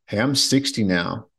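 normal talking voice

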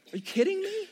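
Joey hearing the news, incredulous. Acted. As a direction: squeak it out in disbelief. Joey's imagined outrage.

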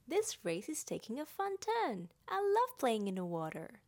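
loud, gleeful little character voice; lively storybook youngster having fun; Roo. A narrator's reading. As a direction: exclaim it loudly with playful delight. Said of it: "said Roo".

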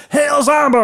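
high-pitched mock holler; imitated clowns' cheer. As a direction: call it back like an excited clown. Clowns yell the catchphrase back.

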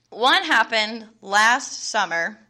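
usual speaking voice

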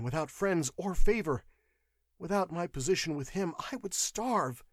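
high, plaintive confession; whimpering clerk. A narrator's reading. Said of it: "whimpering like a beaten dog".

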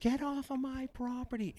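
mimicking the angry neighbour ordering him off her property; mock screaming woman. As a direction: lightly mimic her ranting. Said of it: "screaming it, losing her mind".

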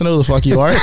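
bragging about a cheeky comeback, laughing; cheeky retort. Retold or imitated. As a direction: tease him, then laugh. Retelling telling off billionaire.

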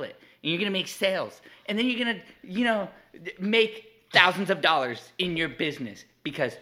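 mock her bestie pep talk, pumped up; imitating wife's voice. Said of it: "Can I do your voice?".

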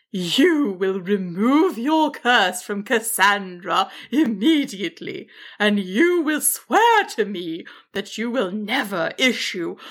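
slow, emphatic dragon ultimatum; commanding dragon. Acted. Dragon orders wizard to lift the curse, commanding. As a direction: lay down the law word by word.

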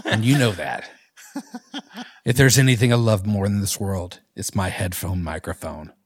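low, soft, earnest country singer; hushed, heartfelt confession with slight pauses. Acted. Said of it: "can you give us some Garth Brooks?".

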